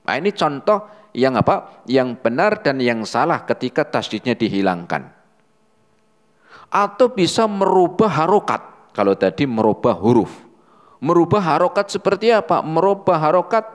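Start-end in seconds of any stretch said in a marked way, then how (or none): none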